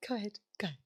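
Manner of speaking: whispered urging to go on; hushed coaxing whisper. Real, not acted. Urging friend to start story.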